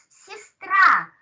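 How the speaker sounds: сестра, stress landing on -ра; stress on the last syllable. Shown as a demonstration. Teacher repeating the word so students hear the stress. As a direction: hit the final syllable of сестра. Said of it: "please mind the stress".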